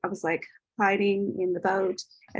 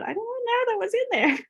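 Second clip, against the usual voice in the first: much higher, wondering; amazed realization. Retold or imitated. Retelling her surprise after the tantrum, amazed. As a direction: marvel at the discovery.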